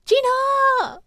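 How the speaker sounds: sharp high call of her name. Acted. Phoenix calls out to Gina, high and sharp.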